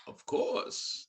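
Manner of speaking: masked villain voice, softly delivered; theatrical villain impression; Bane. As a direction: answer in a put-on movie-villain voice. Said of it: "Did you like Dark Knight Rises, Bane".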